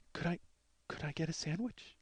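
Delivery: very soft, stammered question; hushed little plea; timid imitation. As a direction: ask meekly, as if afraid to. Imitating timid woman asking.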